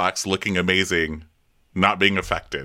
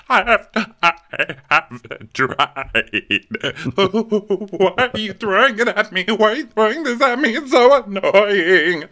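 high, loud mock crying tantrum; Candy; 12 semitones higher, 5 dB louder than usual. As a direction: sob the words out slowly, hammed up. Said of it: "when Candy's crying".

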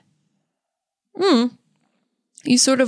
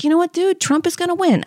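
defiant warning; 8 semitones higher than usual. Retold or imitated. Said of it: "I was like".

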